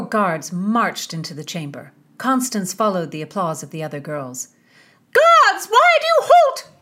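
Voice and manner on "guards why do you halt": very high, loud shriek; crying out. A narrator's reading. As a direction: scream at the guards. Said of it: "cried Mistress Aglynsby".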